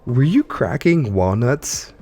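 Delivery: usual speaking voice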